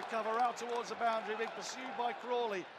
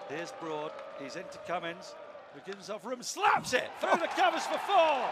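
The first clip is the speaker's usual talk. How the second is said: lively cricket play-by-play call; cricket commentator; 2 semitones higher, 6 dB louder than usual. Real, not acted. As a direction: call the chase ball by ball. Live play-by-play of final overs.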